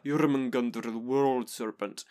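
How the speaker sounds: slow, deep-voiced Norse accent. Shown as a demonstration. A player recalls a Norse accent practised from a video, slow and deep. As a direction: draw out the world serpent in a heavy Norse accent. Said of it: "I did a more, like, deeper, uh, accent".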